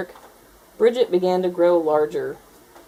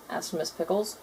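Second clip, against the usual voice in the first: ordinary delivery, nothing marked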